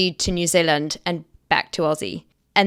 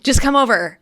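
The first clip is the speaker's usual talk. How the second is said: higher, a keen voice cutting in; lightly voiced excited producer; eager interruption; 6 semitones higher than usual. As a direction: urgently wave the other person on to come over. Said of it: "he was like".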